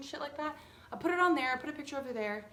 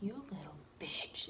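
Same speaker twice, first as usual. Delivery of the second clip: much lower and softer muttered insult; inner mutter; 7 semitones lower, 8 dB quieter than usual. Retold or imitated. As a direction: mutter it under your breath. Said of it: "in my head I am".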